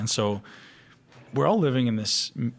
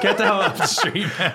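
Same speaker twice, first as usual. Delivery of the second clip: loud, high barking cop, laughing through it; mock cop yell; 9 semitones higher, 6 dB louder than usual. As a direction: play a cop chasing them off the street, half joking. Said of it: "someday they're gonna come and be like".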